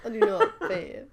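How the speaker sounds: high, loud, laughing protest; laughing denial. Real, not acted.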